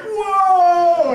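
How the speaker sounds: loud, high drawn-out whoa; big imitated cheer of amazement; amazed crowd whoa. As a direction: holler it like an astonished crowd. Imitating the whole room amazed.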